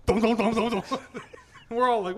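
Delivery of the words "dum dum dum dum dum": loud, laughing "dum dum" thumps; imitated tumbling thuds. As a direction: mimic a body bumping down a slide. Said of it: "fell down the fucking tube slide".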